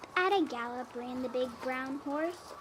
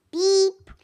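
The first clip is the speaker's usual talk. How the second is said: friendly beep; 6 semitones higher, 9 dB louder than usual. A narrator's reading. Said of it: "said the friendly little blue truck".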